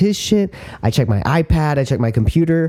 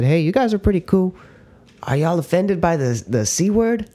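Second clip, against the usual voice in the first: Australian guide; 5 semitones higher than usual. Retold or imitated. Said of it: "with some Australian guy".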